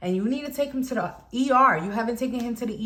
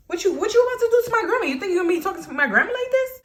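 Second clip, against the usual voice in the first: fast, high, heated challenge; retold indignant confrontation; 10 semitones higher, 4 dB louder, faster than usual. Teller confronts the woman about grandma, fast high indignation. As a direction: fire it off, protective and outraged. Acted quarrel defending grandma.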